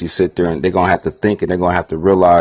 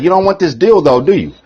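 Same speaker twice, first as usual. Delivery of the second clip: higher, louder teasing coax; mock coaxing; 8 semitones higher, 4 dB louder than usual. Label rep baiting the rapper, mock coaxing. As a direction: bait the rapper. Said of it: "They're like".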